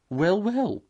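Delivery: high, chuckling small animal; amused Mole. Acted. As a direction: tease it lightly. Mole reacting.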